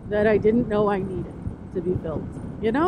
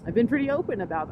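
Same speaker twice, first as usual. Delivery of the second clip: usual speaking voice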